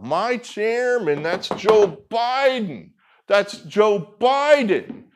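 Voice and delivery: slow, high and loud, hitting "chairman" and "Joe"; emphatic. Real, not acted. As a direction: say "My chairman... That's Joe Biden" slowly, high-pitched, driving it home. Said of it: "This is significant".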